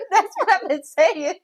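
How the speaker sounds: slow, high and loud, laughing through it; cracking up. Real, not acted. Friend agrees loudly about the hash browns, cracking up. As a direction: drag the words out through big laughter.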